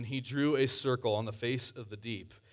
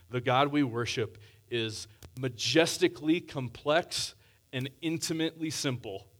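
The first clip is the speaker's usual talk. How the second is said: normal talking voice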